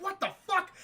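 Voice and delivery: high-pitched startled exclamation; mock disbelief. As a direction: act out a baffled outcry. Retold reaction to asteroid field.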